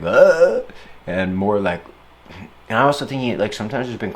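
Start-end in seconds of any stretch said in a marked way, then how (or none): 0.0-0.6 frustrated grunt. Retold or imitated. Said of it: "less like".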